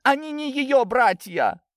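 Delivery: high, drawn-out outraged cry. A narrator's reading. Lidia disowning Natasha, crying out. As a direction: shout the denial slowly and high. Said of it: "выкрикнула Лидия".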